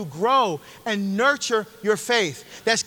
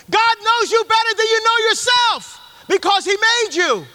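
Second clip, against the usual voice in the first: louder, much higher, preaching at full force; forceful preaching; 11 semitones higher, 6 dB louder than usual. Real, not acted. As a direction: proclaim it to the whole church. Preacher's climax.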